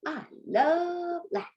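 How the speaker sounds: high-pitched, playful gush; gushing enthusiasm. Shown as a demonstration. Teacher gives students an example translation, gushing playfully. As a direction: exclaim it with exaggerated delight.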